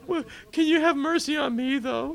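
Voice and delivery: the turned-away Frank begs Peter for mercy in a high voice; pleading Frank. Acted. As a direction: beg to be let in after all.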